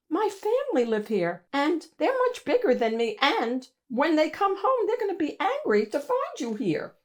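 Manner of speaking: put-on high, hurried child voice; a small boy bravely warning giants; little Mo. Little Mo warning the monsters about his family, high and hurried.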